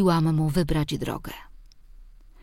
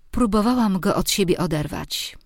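plain reading voice

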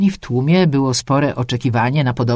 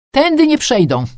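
high, loud, resolute declaration; firm defiant cry; determined; 12 semitones higher, 5 dB louder than usual. A narrator's reading. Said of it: "dodała zdecydowanie".

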